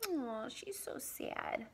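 slow whisper; whispered pity. Acted. Dog pities the kitten in a slow whisper. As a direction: breathe it softly, full of pity. Dog's pitying thought.

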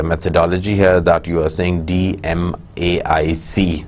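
ordinary delivery

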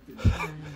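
voiceless, rattling laugh; breathy chuckle. Real, not acted. Amused by mum's reaction, breathy laughing.

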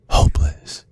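breathy whispered word. Acted. Villain whispering to the priest he holds captive. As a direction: let the word fall to a whisper.

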